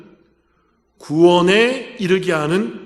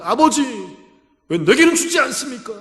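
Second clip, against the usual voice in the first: hammed-up sobbing demand for more; whiny crying plea; 6 semitones higher than usual. Preacher voicing Esau crying to his father for more, high whiny plea. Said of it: "엉엉 울면서".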